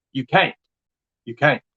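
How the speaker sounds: ordinary delivery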